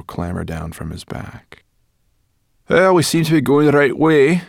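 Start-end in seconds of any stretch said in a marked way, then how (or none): 2.7-4.4 high, loud character voice; brash, raised voice; Sorrel, brusque companion. A narrator's reading. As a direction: pipe up briskly, louder than the narration.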